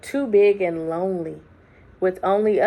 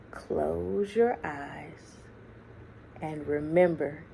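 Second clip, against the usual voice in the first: tender grandmother; 3 semitones lower, 8 dB quieter, slower than usual. Acted. Grandmother's gentle instruction.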